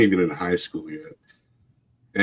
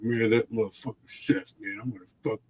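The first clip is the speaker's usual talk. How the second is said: stewing; 9 dB quieter, faster than usual. Retold or imitated. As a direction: imitate a drunk man quietly nursing a grudge. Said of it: "he's, like, stewing".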